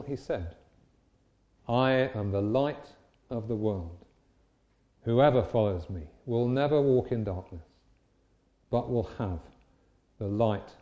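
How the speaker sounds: measured, solemn Bible reading; scripture reading. Acted. Reads scripture aloud.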